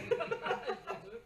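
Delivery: high breathy laugh cracking through; real amused laugh. Real, not acted. Laughs mid-story.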